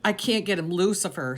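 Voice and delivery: plain reading voice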